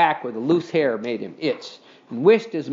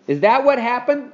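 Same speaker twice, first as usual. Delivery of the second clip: higher, louder demanding question; 7 semitones higher, 4 dB louder than usual. A narrator's reading. Scooter demands the truth from Henry, pushy question. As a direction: needle him for the answer. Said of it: "Scooter demanded".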